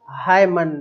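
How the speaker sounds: loud "हाय मन", two separate words; mock misreading. Teacher joking about what not to say, "हाय मन" with N sounded. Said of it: "ऐसे तरीके से नहीं बोलना है".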